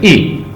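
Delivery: short clipped ई as in sit; ई said short, a quick lax i; short i sound. Shown as a demonstration. Teacher shows the short vowel to students, short and clipped. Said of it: "short ई कर".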